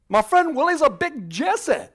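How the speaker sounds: put-on loud, high, drawn-out Scottish accent; hammed-up Scottish brogue; Scotsman impression. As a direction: belt it out as a caricature Scot. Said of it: "you're a Scotsman".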